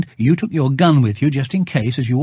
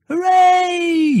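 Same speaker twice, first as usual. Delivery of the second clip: shout of joy. A narrator's reading. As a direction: cheer it up to the sky. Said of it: "you shouted".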